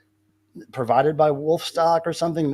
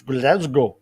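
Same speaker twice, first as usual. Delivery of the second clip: Glasgow accent attempt; 2 semitones lower than usual. Shown as a demonstration. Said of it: "I can't even say it with a Glasgow accent".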